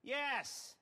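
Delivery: high, sharp exasperated yes. Real, not acted. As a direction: blurt it out, fed up. Exasperated reply to Avon's question.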